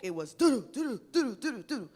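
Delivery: loud, high, clipped doo-doo syllables mimicking a strong cadence; mock the professor's hard, emphatic rhythm; imitating forceful speech. Professor imitated for colleagues, loud emphatic doo-doo. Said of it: "And when she spoke".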